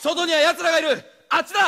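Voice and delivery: high, loud warning cries; urgent calling out. Acted. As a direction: shout the warning while running. Escaping, calling out.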